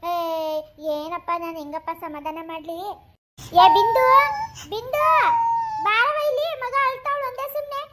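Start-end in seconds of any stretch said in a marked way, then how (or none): 3.6-7.8 high, urgent summons; calling out. Acted. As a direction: call someone over to help. Grandma calls Bindu from afar. (ಕೂಗಿ ಕರೆಯುತ್ತಾ)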